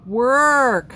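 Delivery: loud, drawn-out "Work". Shown as a demonstration. Telling viewer Kathy to do the work, exaggerated loud "Work". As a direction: belt "Work" with a long, exaggerated vowel. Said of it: "Work with a U".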